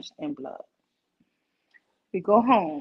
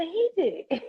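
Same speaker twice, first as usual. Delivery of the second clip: put-on high child voice; 8 semitones higher than usual. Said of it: "And I said".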